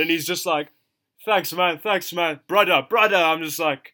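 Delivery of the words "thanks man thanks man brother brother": loud, higher mock gushing; effusive thanks. Imitating Ethan thanking him for saying something, loud and effusive. Acting out the grateful listener's reply.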